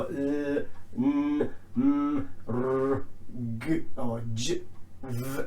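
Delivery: "L, N, M, R, G, or J, V" as hummed consonant sounds; voiced letter sounds. Shown as a demonstration. Teacher showing voiced endings, letters as voiced sounds. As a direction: say "L, N, M, R, G, or J, V" as sounds, not letter names. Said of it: "These are all voiced".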